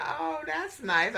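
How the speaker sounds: high, drawn-out "oh, nice"; mock polite reaction. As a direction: imitate mother's slow, high-pitched polite reply. Mother's polite reply on phone.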